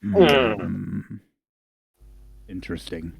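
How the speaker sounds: low, musing scrutiny; lightly voiced appraising giant. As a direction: size someone up with drawn-out hums. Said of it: "like a bird".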